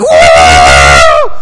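loud, drawn-out excited scream; excited contestant's whoop. Retold or imitated. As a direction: whoop like a contestant called down on a game show. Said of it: "she's like".

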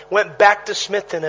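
normal talking voice

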